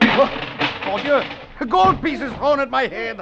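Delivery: high, laughing scorn; mocking laugh. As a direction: laugh through the words. Mocking victim's thrown gold.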